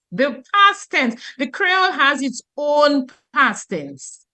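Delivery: usual speaking voice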